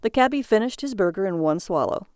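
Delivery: ordinary delivery, nothing marked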